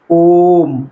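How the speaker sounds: ओम drawn out for three beats; triple-length vowel. Shown as a demonstration. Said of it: "तीन गुना का time लग रहा है".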